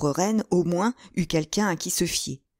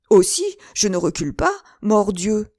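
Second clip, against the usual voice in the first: louder, higher, forced bravado; swearing outcry; 4 semitones higher, 6 dB louder than usual. Acted. The landlord working up his courage, loudly swearing. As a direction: shout the oath to puff himself up. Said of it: "s'écria le bourgeois en jurant".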